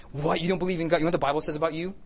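high, incredulous, building to a loud verdict; mock judgmental outrage. Tactless believer quoting scripture at an atheist, mock outrage.

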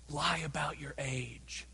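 conspiratorial whisper; whispered aside. Acted. Preacher mocking a wrong reading of the verse, whispered. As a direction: hiss it like a shady tip.

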